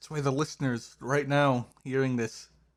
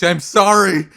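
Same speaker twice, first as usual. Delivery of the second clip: loud, high mock apology; mock confession; 6 semitones higher, 10 dB louder than usual. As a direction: blurt a hammed-up confession. Mock confession of being British.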